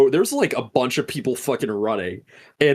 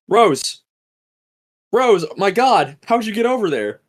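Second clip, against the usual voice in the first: high, loud call across the pit; shouting her name from afar; 9 semitones higher, 6 dB louder than usual. Retold or imitated. Bennett calling out to Rose.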